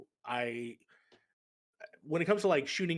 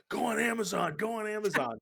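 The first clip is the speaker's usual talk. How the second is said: higher, louder mock crowd shout; urgent retold holler, pitched up; imitated yelling; 9 semitones higher, 4 dB louder than usual. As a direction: yell it like everyone pestering you at once. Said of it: "everyone yelling at me".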